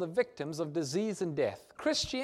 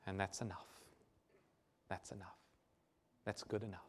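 much softer and lower, gentle; tender reassurance; 9 semitones lower, 14 dB quieter than usual. Acted. Jesus telling the believers it's enough, very softly. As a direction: let each one settle down like a comfort.

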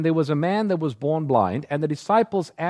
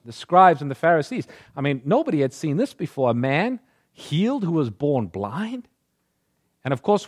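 ordinary delivery

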